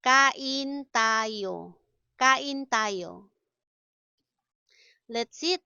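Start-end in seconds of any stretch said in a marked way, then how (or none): 0.0-1.8 stretched, deliberate syllables; slow teaching model. Shown as a demonstration. Teacher models an invitation to eat, slowly. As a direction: draw each syllable out for learners.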